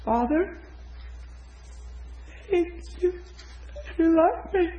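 high, halting, strained little voice; imitating tearful woman. Woman struggling to repeat a prayer, imitated high and halting. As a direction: squeak out a hesitant prayer. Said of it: "She goes like this".

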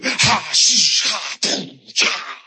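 normal talking voice

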